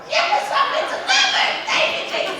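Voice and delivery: high breathy thrilled squeal; joyful squeal. Retold or imitated. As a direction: squeal with delight and gratitude. Overjoyed at offer of housing.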